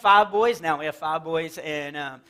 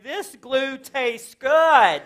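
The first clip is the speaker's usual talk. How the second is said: put-on dopey kid voice; 5 semitones higher, 7 dB louder, much slower than usual. Comic imitating dim child self.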